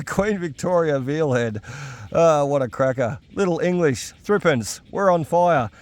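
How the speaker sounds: usual speaking voice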